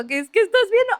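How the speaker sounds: lightly imitated confused relatives; baothered onlookers. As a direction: ask it like a baffled grown-up staring at the wall. People staring like cats.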